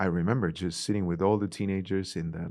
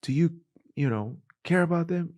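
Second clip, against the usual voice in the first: high-pitched rallying question; preacher quizzing congregation; 9 semitones higher, 3 dB louder than usual. Retold or imitated. Preacher asking churches about youth, rallying. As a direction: call it out to the pews, pitched well up. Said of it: "when I go preaching at churches".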